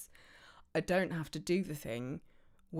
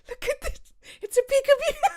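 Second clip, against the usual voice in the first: very high, loud, laughing squeal; gleeful squeal; 12 dB louder, faster than usual. Pretend. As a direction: squeal it with delight, breaking into laughter. Gleeful squeal at the bird name.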